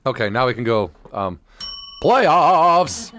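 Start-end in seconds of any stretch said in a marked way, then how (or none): 2.0-3.1 pitched up, louder hype on playoffs; excited announcement. Acted. Host hyping the playoffs to listeners, excited. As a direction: announce it like a big event.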